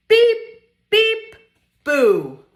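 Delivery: short, high, loud toots; piercing playful beeps; truck horn beeps. Acted. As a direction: honk it like an excited little truck.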